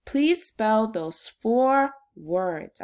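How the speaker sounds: very slow, deliberate request; slow instruction. Real, not acted. Teacher slowly telling second graders to spell.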